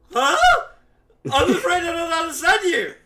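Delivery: loud, high-pitched confused Minotaur; exasperated monster who can't understand you; baffled shout. Acted. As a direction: shout in hammed-up bewilderment. Acts baffled Minotaur answering gibberish.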